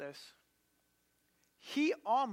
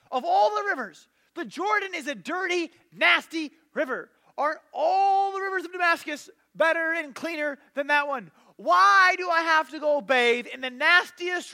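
ranting shout, much higher and louder; angry yelling; 6 semitones higher, 8 dB louder than usual. Retold or imitated. Naaman rants at his servant about the dirty river, furious yelling. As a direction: storm off in a furious tantrum. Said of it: "he's yelling, screaming".

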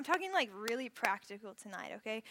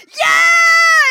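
much higher, much louder yell; team yell; 24 dB louder than usual. Retold or imitated. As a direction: scream it with the whole cabin. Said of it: "still going".

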